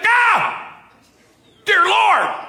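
high, loud cry of dismay as Jacob; shocked Jacob wailing at the bride; dismayed groom. Retold or imitated. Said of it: "He's like".

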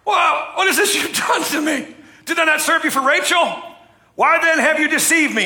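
high, loud indignant shout; accusing Laban. Acted. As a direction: shout at Laban for tricking him. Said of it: "Jacob said to Laban".

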